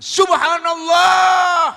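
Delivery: huge, high, drawn-out cry of shock; shocked shout. Acted. Burhan seeing the unveiled face, shocked shout. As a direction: bellow it long and high. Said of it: "Mas Burhan kaget".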